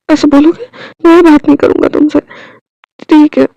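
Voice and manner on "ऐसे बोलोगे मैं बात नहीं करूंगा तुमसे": ordinary delivery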